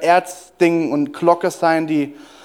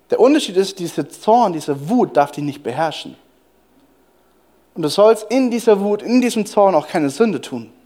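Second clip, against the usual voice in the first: ordinary delivery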